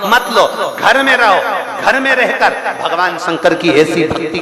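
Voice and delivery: loud, high-pitched exhortation; impassioned preaching. Real, not acted. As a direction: urge the congregation on in ringing, insistent phrases. Preaches at full force. (ज़ोरदार, ऊँचे स्वर में प्रवचन)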